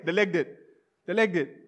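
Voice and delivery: normal talking voice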